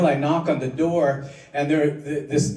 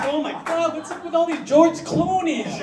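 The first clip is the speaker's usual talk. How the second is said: high-pitched, hammed-up astonishment; mock amazed high squeal. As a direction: squeal it in mock disbelief at the crowd of Clooneys. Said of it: "I'm walking, it's".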